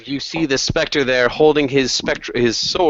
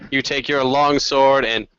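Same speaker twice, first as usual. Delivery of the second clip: normal talking voice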